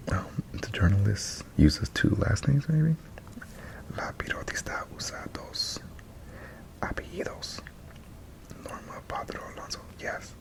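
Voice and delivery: breathy ASMR whisper. Real, not acted. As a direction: whisper it close to the mic.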